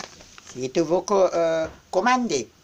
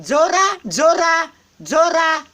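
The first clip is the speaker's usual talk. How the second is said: high, loud call of a name; 9 semitones higher, 7 dB louder, slower than usual. Real, not acted. Calling to Zora who is watching, high loud call.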